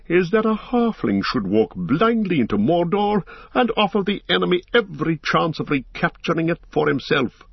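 high-pitched, incredulous outcry; impassioned cry. A narrator's reading. Boromir argues against sending a halfling into Mordor, impassioned high protest. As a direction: protest with the pitch leaping up, volume held. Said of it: "he cried".

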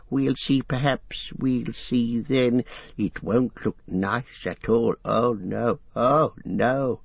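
Gollum fretting about the gate to his master; nervous Gollum. Acted. Gollum frightened and evasive.

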